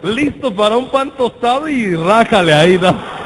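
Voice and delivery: loud laughing punchline. Real, not acted. Preacher cracking up at his gross joke, laughing loudly.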